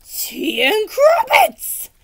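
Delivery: put-on slow, high, loud old knight cry; exclaiming knight. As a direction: bellow it like a dotty old knight. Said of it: "Sir Fartslot exclaimed".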